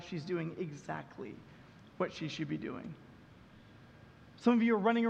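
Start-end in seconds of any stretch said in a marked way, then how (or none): 0.0-2.9 gentle God. Retold or imitated. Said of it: "And God goes".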